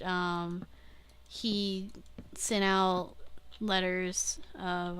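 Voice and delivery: ordinary talk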